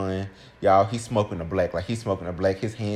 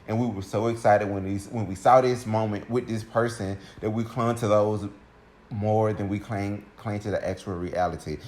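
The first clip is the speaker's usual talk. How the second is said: ordinary delivery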